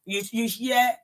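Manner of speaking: ordinary talk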